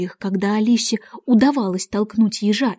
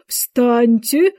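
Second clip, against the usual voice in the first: loud imperious command; piercing shout; 2 semitones higher, 5 dB louder than usual. A narrator's reading. Queen orders the gardeners up, shouting piercingly. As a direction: call it out loudly, piercingly. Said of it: "пронзительно крикнула королева".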